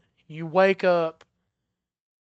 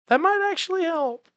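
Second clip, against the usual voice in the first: much higher, quick, pleased realization; high surprised voice; 10 semitones higher, faster than usual. Acted. Attendant to the player, suddenly high and quick with delight. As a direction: squeak it out fast like a sudden bright idea. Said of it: "and he's like".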